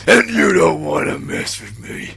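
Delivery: loud, high wrestler growl; Hulk voice. Retold or imitated. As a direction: bellow it like a pumped-up wrestler. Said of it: "We good, Hulk?".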